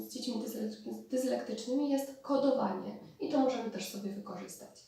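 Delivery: ordinary talk, nothing marked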